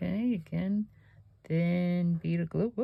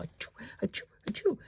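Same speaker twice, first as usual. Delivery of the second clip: soft breathy little sneezes, the last one pitched up; imitating dog sneezes; 6 semitones higher, 6 dB quieter, faster than usual. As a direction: mimic a happy dog sneezing, tiny and whispered. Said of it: "She'll go".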